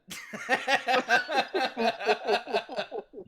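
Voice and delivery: big high burst of laughter; cracking up loudly. Real, not acted. Big laugh at his own joke.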